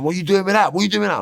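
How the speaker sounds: high, loud accusing yell; brother's shout. Retold or imitated. Older brother catching him with drugs, loud accusing shout. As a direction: bark it like you've caught him red-handed.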